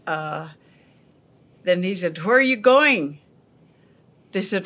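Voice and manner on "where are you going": farmer calling out. Retold or imitated. Farmer asks the girls.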